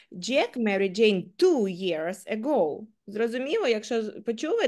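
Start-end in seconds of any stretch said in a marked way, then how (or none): 0.1-2.9 two lifted and stressed, slow. Shown as a demonstration. Teacher shows stress moving to two years. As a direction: weigh down on the word two. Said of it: "я кожного разу виділяла кожне слово".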